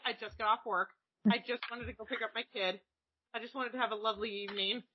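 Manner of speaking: very soft, deflated murmur; quiet, self-pitying grumble; weary retold complaint. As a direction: say it small and defeated. Retells own weary complaint.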